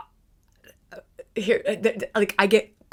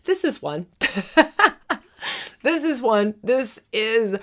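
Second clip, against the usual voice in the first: real burst of high, loud giggles; giggling; 4 semitones higher, 5 dB louder than usual. Real, not acted. Teacher offering a rapid path to spiritual growth, giggling excitedly. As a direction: break into giggles between the repeated words. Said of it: "I just, I giggle".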